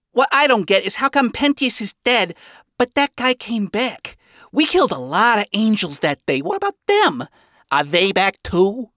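high-pitched, puzzled character voice; sincerely confused, high voice; Angel Dust. A narrator's reading. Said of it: "Angel Dust asked in sincere confusion".

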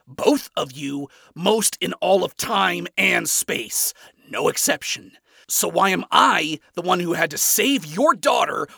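loud, high, emphatic accusation; accusing. Acted. Acted quarrel, accusing the father.

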